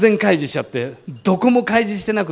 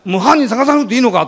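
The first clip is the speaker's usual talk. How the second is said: imitating an excited policeman, higher and louder; worked-up cop; 6 semitones higher, 5 dB louder than usual. Policeman insisting on hunting the culprit, imitated excited. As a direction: press him, eager to chase the culprit. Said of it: "お巡りさんの方が興奮してきた".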